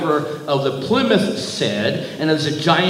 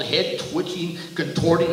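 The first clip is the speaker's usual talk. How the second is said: ordinary delivery, nothing marked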